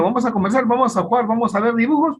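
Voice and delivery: put-on high, lilting invitation to a small child; inviting a child. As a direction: coax a little kid into playing. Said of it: "Si tú le dices al niño".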